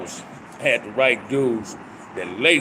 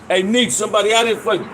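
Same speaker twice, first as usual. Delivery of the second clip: calling out; 8 semitones higher, 3 dB louder than usual. Real, not acted. Calling someone to the door.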